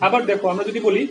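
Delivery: normal talking voice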